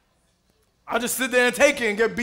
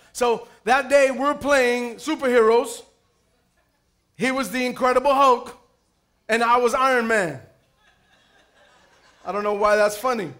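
usual speaking voice

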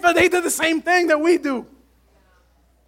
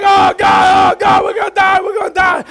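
high, loud panicked cry; hammed-up fear of drowning; mock panic; 5 semitones higher, 5 dB louder than usual. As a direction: play the terrified disciples yelling. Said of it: "disciples are freaking out like".

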